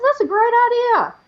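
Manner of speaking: high, loud delighted reply; acted enthusiasm. Woman welcoming Jesus's charity plan, enthusiastic agreement.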